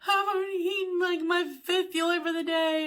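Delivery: high, tearful wail through the words; retelling a tearful complaint to husband, crying at a high pitch. Retold or imitated. Husband urges sticking to plan, she answers in a high tearful whine. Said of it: "I'm, like, crying".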